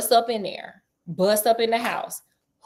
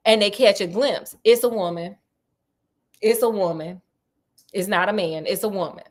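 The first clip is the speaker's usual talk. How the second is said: ordinary talk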